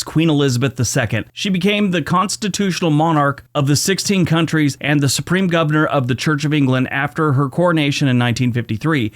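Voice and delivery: normal talking voice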